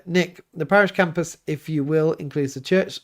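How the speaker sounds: usual speaking voice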